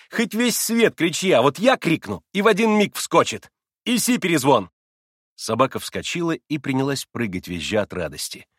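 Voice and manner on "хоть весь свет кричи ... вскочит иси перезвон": proud, raised-voice boast; triumphant boasting. A narrator's reading. Boy proving only he commands the dog, proud. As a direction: announce it victoriously, then command. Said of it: "победоносно и справедливо гордясь прокричал Коля".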